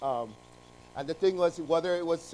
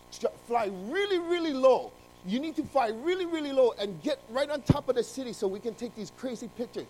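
eager urging; 8 semitones higher, 4 dB louder than usual. Acted. Photographer eagerly urging the pilot.

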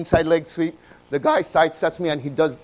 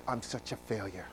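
dejected self-talk; 7 semitones lower, 17 dB quieter than usual. Retold or imitated. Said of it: "I was like".

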